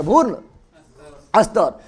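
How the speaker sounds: भ्रूण said alone, long pause, then स्तर; spaced out for dictation. Shown as a demonstration. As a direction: split भ्रूण and स्तर so students can write. (रुक-रुक कर, भ्रूण के बाद ठहराव)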